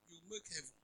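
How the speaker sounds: ordinary delivery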